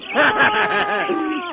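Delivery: vengeful triumphant laughter; gloating laugh. Acted. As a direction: laugh at the enemy's doom. Captives gloat over Sirgoff.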